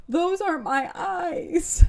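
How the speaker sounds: retold horror line, spooky and slow; eerie line. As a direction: draw it out like a horror reveal. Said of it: "So good".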